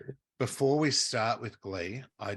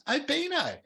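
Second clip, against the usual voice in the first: mock call out; 7 dB louder than usual. Retold calling to a friend.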